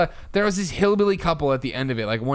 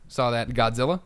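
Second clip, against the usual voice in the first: ordinary talk, nothing marked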